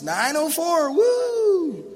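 high, loud, playful cheer on woo; song and dance. Retold or imitated. Father recalling cheering the time for his small son, hammed-up delight. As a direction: ham it up like entertaining a toddler. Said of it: "I did the whole song and dance".

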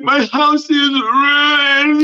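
high, very slow, whiny sob voice; hammed-up weepy falsetto; mock crying girl. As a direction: whine it like a wailing teenage girl. She's crying.

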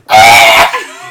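loud mock battle screech; imitated war-cry shriek; mimicked screech. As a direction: screech like a kid charging into battle.

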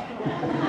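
quick breathy laugh; a little laugh at the joke; short laugh. Real, not acted.

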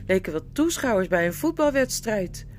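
ordinary delivery, nothing marked

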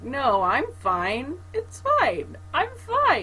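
high, strained mock I'm fine; fake fine while crying. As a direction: pretend you're not crying, voice squeaking up. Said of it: "And I'm like".

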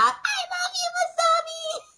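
very high, stretched mock scream; imitated fangirl scream. Fan to singer on stage, imitated high scream. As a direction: squeal it out slowly like an adoring fan. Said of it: "I was screaming out".

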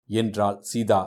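ordinary delivery, nothing marked

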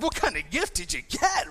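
excited. Retold or imitated. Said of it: "now I'm all excited".

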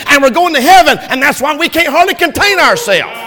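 loud, high preacher's cry; full-force preaching. Acted. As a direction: shout the gospel to the rafters.

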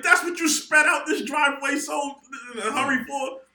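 high, loud, incredulous shouting; going off. Retold or imitated. Son mocking mom's errand for a microwave, going off. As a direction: shriek the question in acted outrage. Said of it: "So I went off on her".

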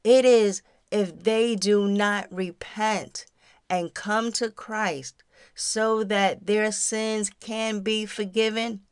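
ordinary talk, nothing marked

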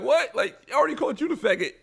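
high and fast, mock indignant.

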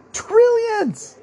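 very high, loud squeak on the word; squeaky disbelief. Real, not acted. Exaggerating a huge sum.

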